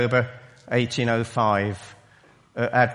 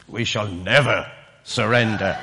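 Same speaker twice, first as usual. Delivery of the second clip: a light imitation of Churchill's drawl, low and slow; hint at the famous wartime drawl; 4 semitones lower than usual. Preacher imitating Churchill's drawl for the congregation, mildly done. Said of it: "his well-known drawl".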